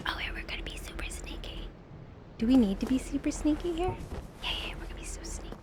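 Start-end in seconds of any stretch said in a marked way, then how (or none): 0.1-1.7 hushed, breath-only whisper; sneaky whisper. Real, not acted. Gamer sneaking by enemies, sneaky whisper. 4.4-5.5 quick, hushed whisper; sneaky whisper. Real, not acted. Gamer confirming the stealth plan, quick sneaky whisper.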